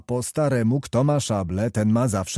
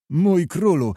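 raised, pleading call to his love; plaintive cry; 7 semitones higher, 4 dB louder, slower than usual. A narrator's reading. Kmicic pleading with Oleńka not to be angry, plaintive cry. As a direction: beg her forgiveness, plaintively. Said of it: "zawołał wreszcie żałosnym głosem".

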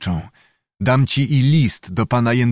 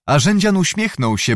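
usual speaking voice

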